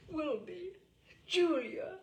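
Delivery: high, loud lovers' exclamations; two lovers calling out, high and loud; lovers' cry. A narrator's reading. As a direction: call each other's names in rapture. Lovers' tender exchange.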